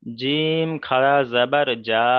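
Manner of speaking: slow correction ending on a long জা; level, stretched model for a pupil; corrective recitation. Shown as a demonstration. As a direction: draw out জিম and the final জা. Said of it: "জিম খারা জাবার জিম হয় না".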